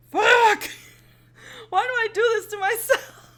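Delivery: loud, high, half-laughing wail; close to crying. Real, not acted. Listener overwhelmed by the feels, tearful wail. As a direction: cry out through a laugh, near tears. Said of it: "I may cry".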